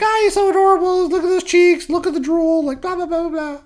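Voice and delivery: put-on high sing-song baby talk; high, gushing nurse voice; cooing nurses. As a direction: coo over the baby like a doting nurse.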